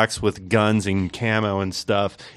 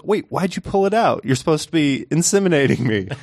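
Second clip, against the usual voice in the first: high, lightly voiced confused woman; puzzled wife; 7 semitones higher than usual. Wife questioning her husband in bed, high puzzled voice. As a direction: ask it like she can't believe it. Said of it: "and she goes".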